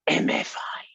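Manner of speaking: breathy conspiratorial whisper of "M F I". Retold or imitated. Toddler creeping up to visitors, whispering. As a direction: whisper the letters sneakily.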